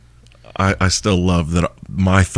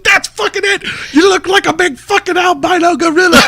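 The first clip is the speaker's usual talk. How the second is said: very high excited British voice; excited exclamation. Retold or imitated. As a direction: exclaim it like Benji. Benji quoted, excited.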